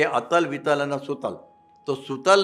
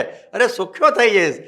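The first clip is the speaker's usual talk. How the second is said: much higher and louder, scoffing reply; loud retort; 8 semitones higher, 8 dB louder than usual. Acted. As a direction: bark back the obvious answer. Preacher's retort to addict. (ઊંચા, મોટા અવાજે ટોણો)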